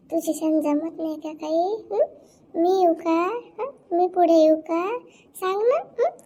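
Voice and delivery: ordinary talk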